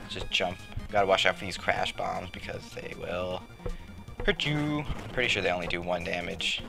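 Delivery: usual speaking voice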